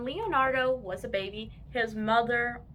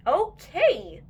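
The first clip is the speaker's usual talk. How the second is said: put-on boy agreeing; 6 semitones higher, 8 dB louder than usual. A narrator's reading. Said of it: "said Leonard".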